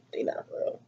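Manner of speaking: breathy confiding whisper; hushed whispered confession; whispered aside. Real, not acted. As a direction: let it slip out as a secret to the camera.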